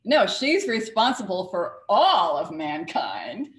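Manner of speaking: slow, loud, ALL held out and punched; stress on ALL. Shown as a demonstration. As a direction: hit the word all, drop the F. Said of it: "You drop the F".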